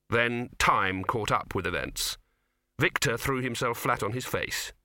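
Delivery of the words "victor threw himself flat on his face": ordinary delivery, nothing marked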